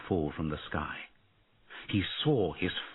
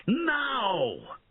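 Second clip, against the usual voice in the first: spring the ambush; ambush cry. A narrator's reading. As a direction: rebel leader signalling the peasants to strike. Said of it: "Bowen shouted out".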